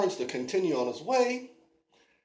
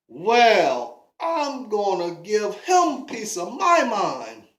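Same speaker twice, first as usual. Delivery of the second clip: loud, slow indignant grumble; Davy annoyed at the spitter, loud and indignant; fuming; 4 semitones higher, 7 dB louder than usual. Acted. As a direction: work yourself up to tell him off. Davy fumes at the spitting stranger.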